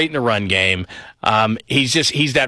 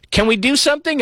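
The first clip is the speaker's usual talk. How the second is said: much higher, pleading wife voice; wife asking; 13 semitones higher than usual. Retold or imitated. Husband imitating his wife asking to go out. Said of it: "she looks at me and goes".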